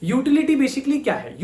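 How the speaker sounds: normal talking voice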